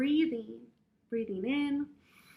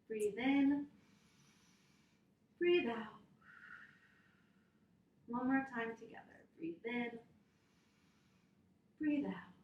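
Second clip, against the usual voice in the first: calm guided breathing; 3 semitones lower, 4 dB quieter than usual. Acted. Teacher guiding children through calm breathing. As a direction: lead the little ones gently through breaths.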